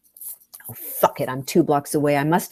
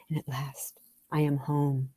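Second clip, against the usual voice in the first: whisper; 2 semitones lower, 7 dB quieter than usual. Acted. Blissful first bite.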